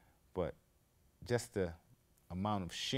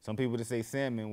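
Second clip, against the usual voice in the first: ordinary delivery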